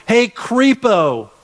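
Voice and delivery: hammed-up shout of insults; playful pretend yell; mock yell. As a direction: call out like you're taunting him. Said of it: "I would have probably yelled".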